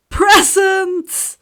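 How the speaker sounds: high, loud excited cry; gleeful shout. Acted. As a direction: shout it like a child at Christmas. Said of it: "he exclaimed".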